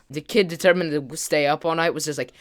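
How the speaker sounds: ordinary talk, nothing marked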